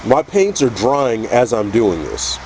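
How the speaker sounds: ordinary delivery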